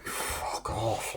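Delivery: breathy whispered fuck off under his breath; whispered curse. Real, not acted. Reviewer whispers his annoyance at the villain. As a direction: hiss it quietly to yourself.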